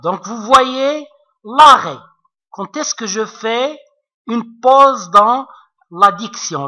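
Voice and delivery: ordinary talk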